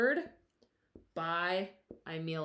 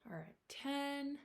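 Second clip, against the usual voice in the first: whispering; 6 semitones higher, 8 dB quieter than usual. Real, not acted. Countdown starts in whisper.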